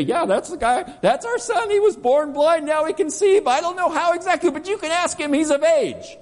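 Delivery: high, frantic defensive babble; hammed-up panicky parents disowning responsibility; scared parents. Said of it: "his parents are so scared to say".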